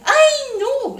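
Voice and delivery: sudden high, loud "ay, no"; alarmed cry. Acted. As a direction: yelp as the chair gives way. Chair breaks in story. (grito de sorpresa)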